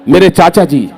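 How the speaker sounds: normal talking voice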